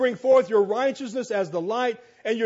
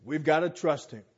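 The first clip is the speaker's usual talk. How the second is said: normal talking voice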